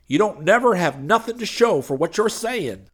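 high, pleading hustler voice; pushy street preacher. A narrator's reading. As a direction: wheedle him like a smooth con man. Hoover Schultz voiced.

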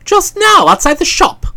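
high insistent reply; pressing. Acted. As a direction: press the point. Bertie pressing.

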